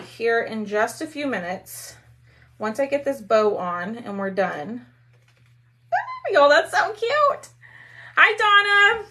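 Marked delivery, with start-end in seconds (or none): 5.9-9.1 very high, delighted squeal; excited gushing. Real, not acted. Crafter cooing over a cute bow and greeting a viewer, high-pitched gushing. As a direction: gush in a much higher, louder voice.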